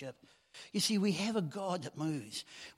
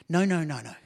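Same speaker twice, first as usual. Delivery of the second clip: emphatic no; 8 dB louder than usual. Real, not acted. Preacher rejecting a false belief.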